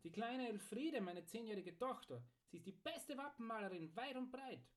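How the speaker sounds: high, lilting fatherly pride; proud. A narrator's reading. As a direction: boast about your talented child. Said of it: "Wieland, der Schmied, stolz".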